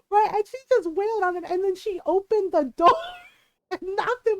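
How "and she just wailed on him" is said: ordinary delivery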